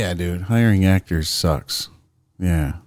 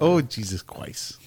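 slow, higher mock Chinese accent; 4 semitones higher, 6 dB quieter than usual. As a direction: repeat it in a put-on Chinese accent.

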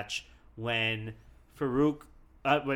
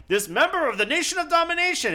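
high, fast announcer voice; mock announcer; 14 semitones higher, 4 dB louder, faster than usual. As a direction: rattle it off like a ring announcer. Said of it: "they're just like".

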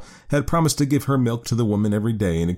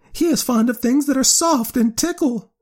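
very high, louder squeaky bat; high piping bat chatter; 4 dB louder than usual. A narrator's reading. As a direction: pipe it up high like a tiny bat. Bat character voice.

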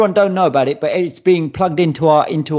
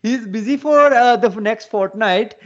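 higher-pitched put-on Punjabi English; Punjabi accent; 4 semitones higher than usual. Reading wife's text to co-hosts, Punjabi accent. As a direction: read the text aloud in a mock Punjabi accent. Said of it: "let's hear his accent".

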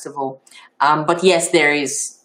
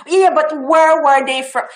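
higher, louder insistent probing voice; imitating a pushy questioner; 8 semitones higher, 4 dB louder than usual. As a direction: play the person who won't drop it.

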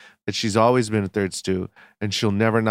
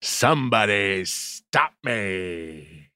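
Al Pacino impression; 4 semitones lower, much slower than usual. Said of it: "Is that Al Pacino?".

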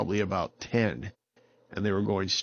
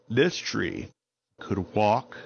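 ordinary delivery

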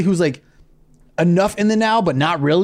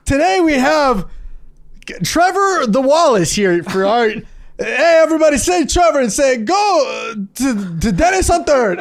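mock dentist shoutout; 8 semitones higher than usual. Podcaster mocking a dentist's influencer promo, hammed-up announcer. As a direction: hype the influencer like a cheesy ad. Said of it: "the dentist is like".

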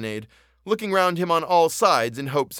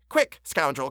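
impatient order; slower than usual. A narrator's reading. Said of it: "continued to punch him in the back".

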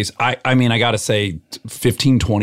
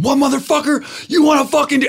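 high-pitched combative challenge, acted out; unhinged; 14 semitones higher, faster than usual. Storming across the lot at the bodybuilder, unhinged high-pitched challenge. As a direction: re-enact flying off the handle. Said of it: "And I'm unhinged".

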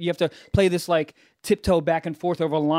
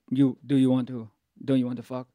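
lower-pitched, badly faked French accent; 6 semitones lower than usual. French girl asks him at a bar, bad French accent. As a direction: put on a clumsy French accent, pitched low. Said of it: "My French accent's horrible".

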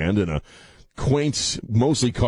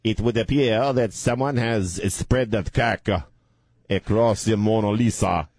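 put-on Clouseau French accent; 2 semitones higher than usual. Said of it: "I am Inspector Clouseau".

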